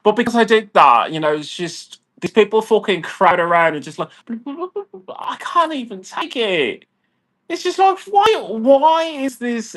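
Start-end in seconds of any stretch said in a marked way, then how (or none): 4.3-9.8 high, drawn-out, strained disbelief; exasperated whine. Real, not acted. Venting about people crowding his food, high exasperated whine. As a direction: stretch it out like you can't take it.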